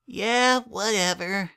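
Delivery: put-on high childish mumble, trailing off; a drowsy filly brushing it off; sleepy filly mumbling. A narrator's reading. Twilight mumbles to her brother while being tucked in, sleepy. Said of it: "the sleepy little filly mumbled".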